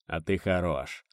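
soft, slow amused praise; quiet approving grunt; good-natured chuckle. A narrator's reading. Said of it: "добродушно хмыкнул он".

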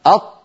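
أط closed tight on the taa; pressed final taa. Shown as a demonstration. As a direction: shut the air off in أط. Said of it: "جربوا لو سمحتم". (طاء ساكنة مضغوطة)

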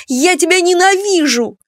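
high, loud cry of hatred; shouted outburst. A narrator's reading. Daughter shouting hatred at her mother, furious outburst. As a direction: scream it at her before storming out. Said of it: "выкрикнула Клара".

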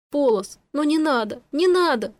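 high-pitched, distressed begging; upset, rising plea; tearful pleading. A narrator's reading. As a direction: plead with a friend not to die. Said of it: "Слёзы побежали из глаз".

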